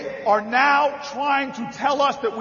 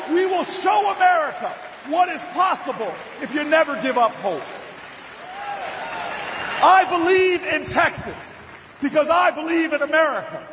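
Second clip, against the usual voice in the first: fiery campaign rally cry; rally speech at full force; 4 semitones higher than usual. Acted. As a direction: preach it to the crowd, building. Rousing rally crowd.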